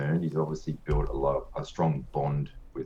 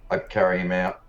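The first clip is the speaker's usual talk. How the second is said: ordinary delivery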